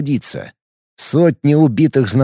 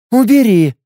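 disgusted; 9 semitones higher, 5 dB louder than usual. Acted. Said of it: "с отвращением кивнула".

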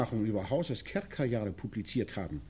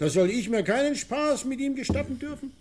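mock-indignant, pitched higher; scornful quote; 8 semitones higher, 3 dB louder than usual. Retelling Wessenich's scornful words with mock indignation. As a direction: sneer out the excuse. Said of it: "sagte er".

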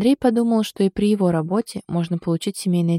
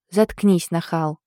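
plain reading voice

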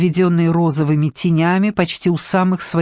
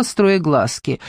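plain reading voice

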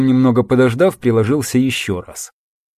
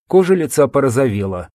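ordinary delivery, nothing marked

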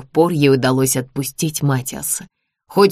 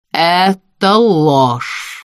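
slow, drawn-out furious cry; angry denial; 3 semitones higher, 4 dB louder, much slower than usual. Acted. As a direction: spit out each word in outrage. Королева гневно отрицает правду. (медленный гневный выкрик)

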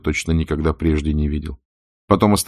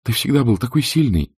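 pleading Scarlett; 9 semitones higher than usual. A narrator's reading. Scarlett pleading, voiced high.

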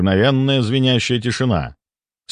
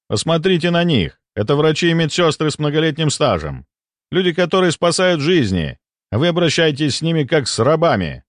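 higher-pitched, emphatic defence of colleagues; impassioned rebuke; 6 semitones higher than usual. A narrator's reading. Doctor defends staff treated like slaves, impassioned. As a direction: drive the accusation home.

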